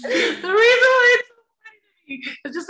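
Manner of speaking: real belly laugh breaking through the words; can't stop laughing, high and cracking; laughing hard. Real, not acted. Telling the hosts about hate mail, laughing helplessly.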